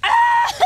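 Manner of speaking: loud high shriek into laugh; scream, then burst out laughing; startled scream. Retold or imitated.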